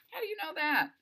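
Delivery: high-pitched, pointed question; challenging. Acted. Delphi questions the boy about missing chargers, high challenging tone. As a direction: demand an explanation, pitched well up.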